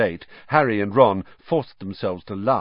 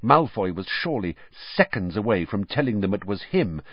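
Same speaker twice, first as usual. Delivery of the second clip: ordinary talk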